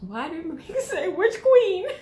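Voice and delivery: high, helpless laughing read. Real, not acted. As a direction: read it while cracking up. Cracking up at a listener's comment.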